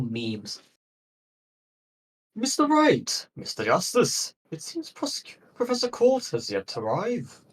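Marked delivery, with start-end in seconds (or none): 2.4-7.4 grandiose Viking professor, higher. Acted. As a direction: boom it out like a pompous Viking scholar. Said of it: "what Viking voice do I give him".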